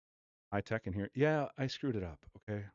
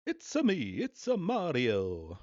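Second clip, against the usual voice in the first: high It's-a me, then a slow drawn-out Mario; Mario impression; 6 semitones higher, 3 dB louder, slower than usual. Streamer mimicking the Mario ad again, in a slow high Mario voice. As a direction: play the plumber, stretching the name.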